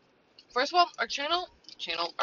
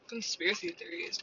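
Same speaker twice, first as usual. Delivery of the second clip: usual speaking voice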